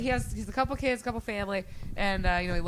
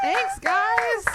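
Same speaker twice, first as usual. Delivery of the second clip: very high, loud, sing-out thanks; high-pitched cheer to the contributors; excited thanks; 6 dB louder than usual. Real, not acted. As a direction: call out a gushing thank-you.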